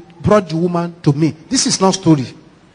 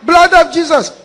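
panicked shout, much higher and louder; terrified shout; 12 semitones higher, 7 dB louder, much slower than usual. Retold or imitated. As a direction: scream "blood of Jesus" in terror. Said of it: "he shouted".

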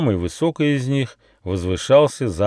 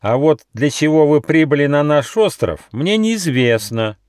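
fierce fox; 7 semitones higher, 6 dB louder than usual. A narrator's reading. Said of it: "стало свирепым".

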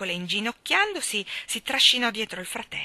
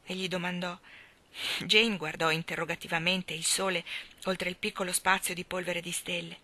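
ordinary delivery, nothing marked

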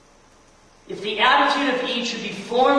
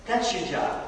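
voice of Jesus; 9 dB quieter, slower than usual. Retold or imitated. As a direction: breathe it softly as the Lord's command. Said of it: "finally just Jesus saying".

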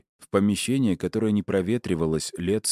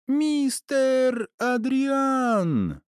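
drawn-out high-pitched squeal of delight; joyful cry; much slower than usual. A narrator's reading. Little girl greets the narrator from behind, joyful cry. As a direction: call the name out very high, stretching every vowel. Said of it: "раздался позади радостный вопль".

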